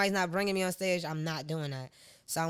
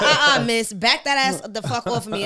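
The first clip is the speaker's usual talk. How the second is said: laughing telling-off; 4 semitones higher, 9 dB louder than usual. Retold or imitated. Retold telling off dancing girl.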